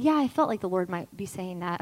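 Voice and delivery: low, soft, offhand counselor voice; imitated casual reply. Counselor calmly confirming to crying client, casual and understated. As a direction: toss it off quietly as no big deal. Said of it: "So casual".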